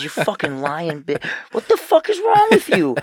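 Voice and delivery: hammed-up rage climbing high and loud; mock furious yell. Son mock-raging at mom over Nutella. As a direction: blow up at her in mock fury. Retelling cursing out his mom.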